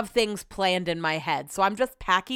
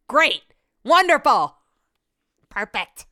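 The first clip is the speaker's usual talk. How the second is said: louder sarcastic cheer; 3 semitones higher, 6 dB louder, slower than usual. Real, not acted. Period coming soon, sarcastic fake cheer. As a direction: fake delight, dripping sarcasm. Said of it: "feeling bloated as hell".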